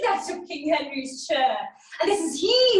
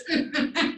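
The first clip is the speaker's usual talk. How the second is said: staged mocking chuckle; gloating laugh; 2 semitones higher than usual. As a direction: laugh at a beaten enemy. Mocking the captive.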